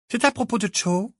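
high-pitched businesslike question. A narrator's reading. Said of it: "d'un ton de femme d'affaires". (ton de femme d'affaires, voix aiguë)

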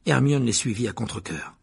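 ordinary delivery

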